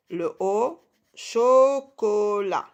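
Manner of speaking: CHOCOLAT very slow, each syllable drawn out. Shown as a demonstration. Teacher showing two O syllables, syllable by syllable. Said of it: "les deux premières syllabes".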